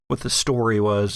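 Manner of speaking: ordinary talk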